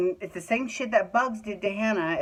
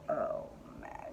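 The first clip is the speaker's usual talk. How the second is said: a soft breathy sigh of longing; whispered swoon over a crush; 3 semitones lower, 12 dB quieter than usual. Real, not acted. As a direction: sigh his name, smitten. Swooning over hot steward.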